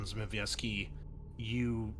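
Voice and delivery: usual speaking voice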